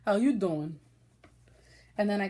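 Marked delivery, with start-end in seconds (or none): 0.0-0.8 a hammed-up Brooklyn "How you doing?" with "you" leaned on; Brooklyn greeting again. Telling viewers she's Brooklyn, in a Brooklyn accent. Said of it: "'Cause I'm Brooklyn".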